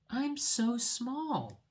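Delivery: very high, slow and small; tiny mole voice. Acted. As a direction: stretch it out like a tiny creature. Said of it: "said the mole".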